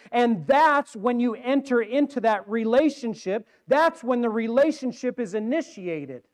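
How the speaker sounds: impassioned sermon delivery, pushing each key word; forceful preaching. Real, not acted. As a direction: drive the point home to the congregation, high and loud.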